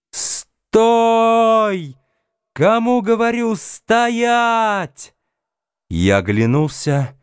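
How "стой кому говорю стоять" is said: very high-pitched imitation of a yelling boy; frightened child's cry. A narrator's reading. Boy calling after his runaway dog, high frightened cry. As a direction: yell a command in a high child's voice. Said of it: "перепуганный детский крик".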